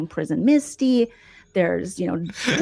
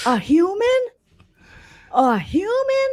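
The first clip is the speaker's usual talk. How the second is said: high, shocked double take; mock disbelief, pitched way up; hammed-up outrage, much higher; incredulous; 12 semitones higher, slower than usual. Mocking shocked reaction, quoted.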